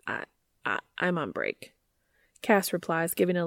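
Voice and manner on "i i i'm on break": low, stammering and flustered. Acted. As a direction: stumble over the words, deep voice. Cass stammering nervously.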